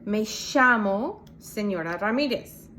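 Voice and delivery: llamo said "shamo"; Argentine sh. Shown as a demonstration. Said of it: "sound like a sh".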